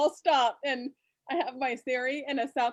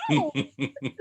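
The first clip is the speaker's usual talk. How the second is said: deep mock no; 13 semitones lower than usual. Voiced at the phone.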